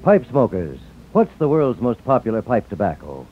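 ordinary delivery

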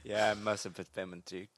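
ordinary delivery

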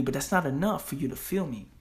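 normal talking voice

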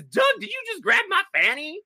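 high, indignant "Doug, did you just grab my fanny?"; mock outrage. Jackie confronting Doug after he grabs her, mock outrage. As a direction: squeal it like a shocked slapped woman. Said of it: "slaps him and says".

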